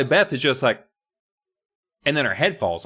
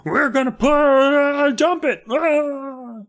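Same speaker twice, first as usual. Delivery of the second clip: much higher, louder goofy bully voice; high-pitched mock bully; dumb bully voice; 3 dB louder, slower than usual. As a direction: squeak it out like a panicky dim bully. Bullies voiced in retelling.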